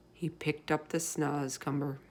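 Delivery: plain reading voice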